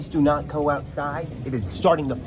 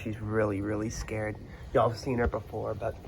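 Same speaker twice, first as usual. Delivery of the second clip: normal talking voice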